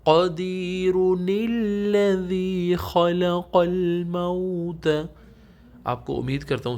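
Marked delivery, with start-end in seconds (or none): 0.0-4.9 قدیر joined to الذی through the نون, slow and chanted; joined reading. Shown as a demonstration. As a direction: carry the نون into الذی without stopping. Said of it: "stop نہیں کیا پھر آپ پڑھیں گے". (بغیر رکے نون ملا کر)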